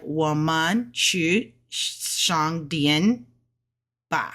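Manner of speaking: 慢速中文示范; slow Mandarin sentence. Shown as a demonstration. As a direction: spell out woman去商店吧 for learners. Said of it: "So it's like".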